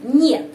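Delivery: "Нет" loud, like "nyet" in monyet; pronunciation demo. Shown as a demonstration. Teacher likens it to monyet, clearly pronounced "Нет". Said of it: "pengucapannya seperti nama binatang".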